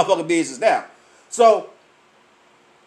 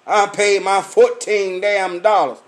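normal talking voice

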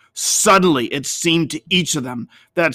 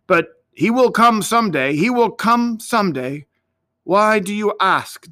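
lightly lifted, higher pitch; rising hope; 6 semitones higher than usual. A narrator's reading. Older shepherd reassures the boy, hopeful and higher. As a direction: insist with renewed hope.

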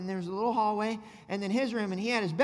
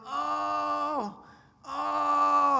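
hammed-up higher groan of a hurt kid; mock pained groan; 5 semitones higher than usual.

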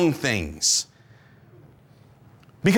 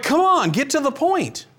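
impatient viewer; 8 semitones higher than usual. Acted.

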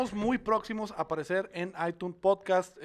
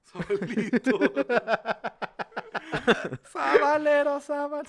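laughing; 10 semitones higher, 8 dB louder, slower than usual. Real, not acted. Laughing riff on name.

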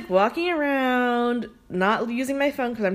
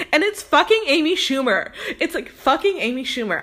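higher, emphatic, starstruck disbelief; excited reveal; 5 semitones higher, 4 dB louder than usual. Real, not acted. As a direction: land the big reveal with amazement. Big celebrity reveal in her story.

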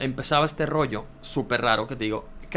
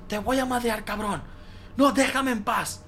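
high mock yell, slowing on the last plea; imitated sleep shouting; 10 semitones higher than usual. Friend retells him yelling in his sleep, high mock shouting. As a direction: shout it like a sleepwalker fighting someone off. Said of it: "estabas gritando".

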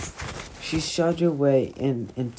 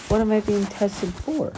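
shocked; 7 semitones higher, 4 dB louder than usual. Acted. Sophie shocked, high voice.

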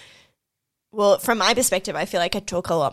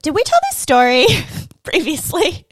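quoting a text to mum; 8 semitones higher, 7 dB louder than usual. Retold or imitated. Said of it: "be like".

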